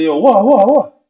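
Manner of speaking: high, loud startled yelps. Retold or imitated. Player snapped out of a daydream, startled loud reply. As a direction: jolt awake and answer fast.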